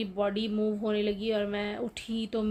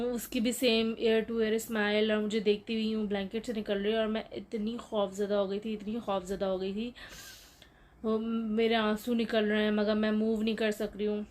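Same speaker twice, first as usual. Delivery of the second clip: ordinary delivery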